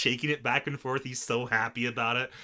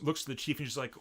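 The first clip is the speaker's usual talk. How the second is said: normal talking voice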